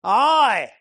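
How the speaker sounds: put-on "Aye" in a broad Essex accent, loud and high; broad Essex accent exclamation. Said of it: "he said in a broad Essex accent".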